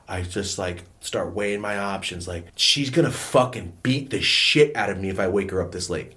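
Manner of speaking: ordinary delivery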